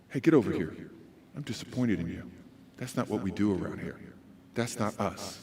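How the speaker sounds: very low, soft reproof; quiet disappointment. Shown as a demonstration. Parent to child who misbehaved, quietly disappointed. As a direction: let disappointment weigh on each sentence. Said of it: "when you show how disappointed you are in them".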